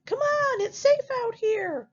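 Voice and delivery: very high piglet voice; high-pitched, urging storybook pig; first little pig. Acted. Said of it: "the first little pig said".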